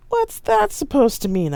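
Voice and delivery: high, loud indignant challenge. Acted. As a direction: snap back, offended. Staged quarrel.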